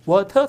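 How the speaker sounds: water with a real T and no final R; British water. Shown as a demonstration. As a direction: say water as "waw-tuh". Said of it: "T를 살려".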